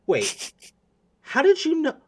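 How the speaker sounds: sudden high startled gasp; startled girl. Acted. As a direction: caught off guard, voice jumps up.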